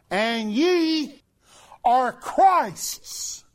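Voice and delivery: high, loud scripture cry; preaching shout. Real, not acted. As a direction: declare the verse to the whole room. Quoting scripture at full force.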